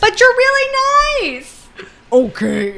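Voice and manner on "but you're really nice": much higher, slow gushing voice; sugary high voice mocking the heroine; mocking sweet girl. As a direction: gush like a lovestruck maid. Mocking the maid.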